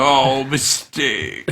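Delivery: slow, drawn-out snide correction; mock-bitchy drawled "Oh, mistake". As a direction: drag it out, dripping with snark. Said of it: "I'm gonna be bitchy and be like".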